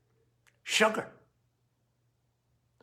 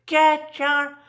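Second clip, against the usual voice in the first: higher, louder mock scream at a stranger; retold scream; 10 semitones higher, 5 dB louder than usual. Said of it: "and she just screamed".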